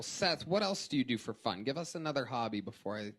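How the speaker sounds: ordinary talk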